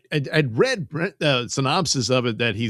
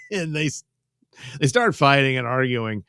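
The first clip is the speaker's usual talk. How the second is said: usual speaking voice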